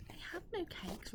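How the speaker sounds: a frightened little rabbit pleading; timid rabbit. Acted. Rabbit answers the rat.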